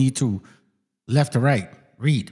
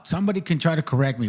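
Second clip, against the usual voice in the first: usual speaking voice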